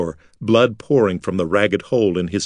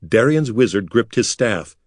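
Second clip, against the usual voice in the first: usual speaking voice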